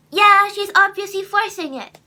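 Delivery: high, very loud dismissive imitation; mocking online commenter. Vlogger imitating a commenter who doubts her voice, scornful. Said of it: "I get so many comments".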